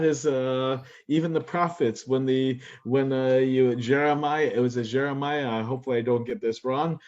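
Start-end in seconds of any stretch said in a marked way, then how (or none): none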